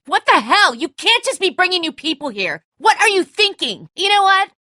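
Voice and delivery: high, loud, furious outburst; furious yelling. Acted. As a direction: lay into him for bringing strangers. Angry quarrel over bringing strangers.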